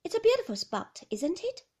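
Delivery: high, chirpy small talk; bright cheerful nurse. Acted. The nurse chats to Mrs de Winter, high and cheerful. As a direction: brightly make conversation. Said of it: "very bright, very cheerful".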